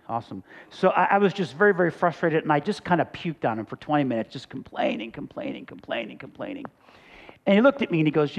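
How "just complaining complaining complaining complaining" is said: "complaining" repeated, each softer until it is almost breath; fading away. Retold or imitated. Pastor mocking his own endless griping, fading to nothing. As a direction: let "complaining" die away into a whisper.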